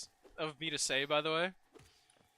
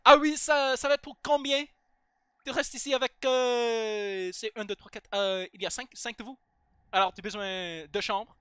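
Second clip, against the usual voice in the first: French-speaking elf; 5 semitones higher than usual. Retold or imitated. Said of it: "They were like".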